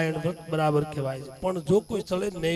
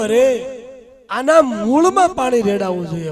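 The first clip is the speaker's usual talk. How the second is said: louder and higher, insisting; mother driving the point home, raised voice; mother calling out; 5 semitones higher, 8 dB louder than usual. Acted. Mother explains watering the roots. (મોટેથી ભાર દઈને સમજાવતી મા)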